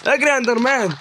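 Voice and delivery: high, loud call to run; yelling at the Enderman to run off. Real, not acted. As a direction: shout at the mob to run.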